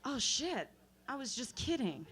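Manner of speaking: soft, casual dismissal; a lightly voiced guy taking it back; offhand guy. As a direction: toss it off lightly. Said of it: "And then he said".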